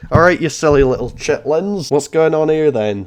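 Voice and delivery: loud mock-angry greeting; loud teasing challenge to the lobby; going mad. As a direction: burst in loudly as if furious. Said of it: "Let me go mad".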